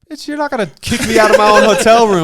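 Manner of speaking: loud, high, laughing retort; indignant loud laughing yell; retold outburst. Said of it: "I'm like".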